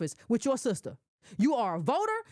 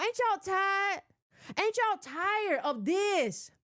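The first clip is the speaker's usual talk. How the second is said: slow weary chiding; 6 semitones higher, much slower than usual. Real, not acted. Host to her viewers, slow exasperated drawl. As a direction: draw it out slowly, fed up.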